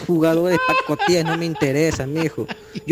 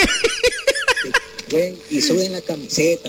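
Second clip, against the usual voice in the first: much higher, slow squeaky replay of his own line; sped-up high voice; 13 semitones higher, slower than usual. Acted. Replaying the fan's rant in a high squeaky voice. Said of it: "¿Cómo dice que?".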